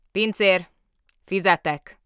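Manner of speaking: loud, curt call; brusque summons. A narrator's reading. Director calling the waiter to pay, brusquely.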